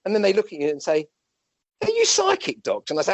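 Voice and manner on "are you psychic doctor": high, lightly voiced incredulous question; mock wondering patient. Doctor imitating a patient's high wondering question. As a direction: ask it wide-eyed, in a higher voice.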